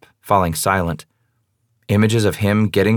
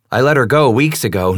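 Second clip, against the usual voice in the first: ordinary delivery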